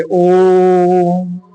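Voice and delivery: ओम held long and drawn out as a pluta vowel. Shown as a demonstration. Teacher drawing out ओम for students. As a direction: stretch ओम slowly over three beats. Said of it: "थोड़ा सा लमार के पढ़ेंगे".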